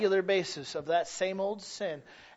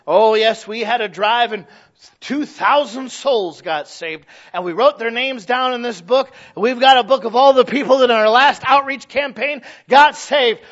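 loud, raised-pitch mock brag; mock church boasting; 5 semitones higher, 11 dB louder than usual. Preacher voicing churches bragging about souls saved, loudly. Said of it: "Hey, even nowadays".

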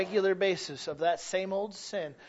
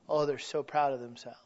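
ordinary talk, nothing marked

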